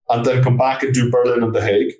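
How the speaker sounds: ordinary delivery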